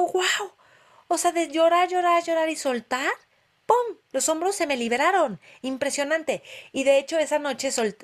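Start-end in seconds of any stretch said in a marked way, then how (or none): 0.0-0.5 high, delighted gasp of wonder; thrilled surprise; amazed wow. Retold or imitated. Amazed at shoulders healed. 1.1-6.4 excited. Real, not acted. Excited retelling of release.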